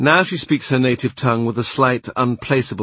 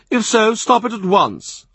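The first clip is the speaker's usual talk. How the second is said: higher, louder imperious churchman; angry Cardinal; 11 semitones higher, 4 dB louder than usual. A narrator's reading. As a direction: pounce on every excuse, rising in anger. Cardinal orders clerk.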